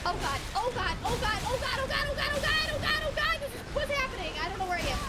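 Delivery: repeated cries climbing high and loud; panic, pitch rising as it piles up; high, frantic gamer panic; real panic. Real, not acted. Panicking, lost and chased in game.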